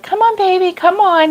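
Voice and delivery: high, coaxing call to a dog. Retold or imitated. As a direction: sweet-talk a frightened dog closer. Calling the scared dog.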